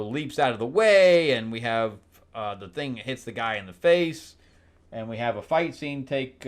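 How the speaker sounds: usual speaking voice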